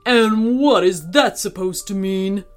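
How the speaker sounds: mildly huffy gorilla. Acted. Gorilla questioning parrot's jibe, huffy. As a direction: huff out an offended question. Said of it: "huffed the gorilla".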